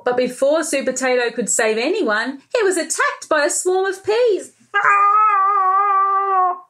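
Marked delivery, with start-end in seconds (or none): 4.7-6.6 scream. Acted. Attacked by peas.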